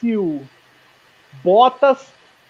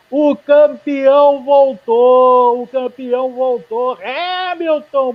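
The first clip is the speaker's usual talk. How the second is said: slow, loud triumphant call; triumphant shout; 4 semitones higher, 4 dB louder, much slower than usual. Real, not acted. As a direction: proclaim the champion is back. Proclaiming the champion's return.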